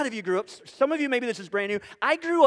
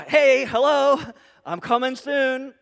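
loud "Hey, hello", then slower; loud mock call; 4 semitones higher, 7 dB louder, slower than usual. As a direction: shout to get their attention. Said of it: "All right. Uh".

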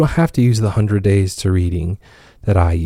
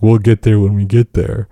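relaxed. Retold or imitated. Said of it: "I'm like".

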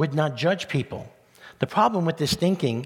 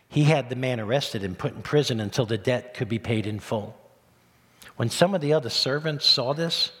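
normal talking voice

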